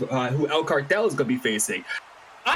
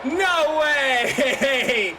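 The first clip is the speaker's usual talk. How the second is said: shocked shouting; 7 semitones higher, much slower than usual. Real, not acted. Shocked by the surprise team.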